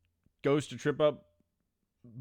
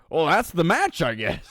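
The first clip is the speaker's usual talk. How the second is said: mock announcer shrug; 5 semitones higher, 6 dB louder than usual. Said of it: "they were like".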